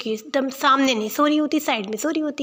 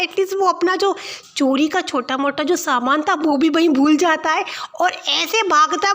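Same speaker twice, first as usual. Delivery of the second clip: usual speaking voice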